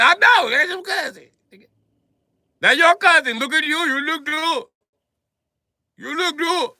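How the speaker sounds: high, loud mock flattering tone; sing-song flattery; high teasing voice. Comic flatters the clerk in a high teasing voice. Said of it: "I said".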